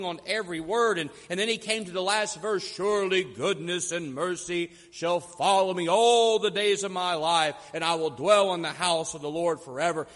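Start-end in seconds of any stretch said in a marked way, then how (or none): 2.7-10.1 loud, grand recitation of scripture; captivating orator. Acted. Orator reaching the last verse before a rapt crowd, captivating grand delivery. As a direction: project it like a great orator's finale. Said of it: "began in his deep, captivating voice".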